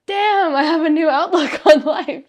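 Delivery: loud, high exclamation with a laugh; excited revelation. Retold or imitated. Telling how session one felt, excited and amazed.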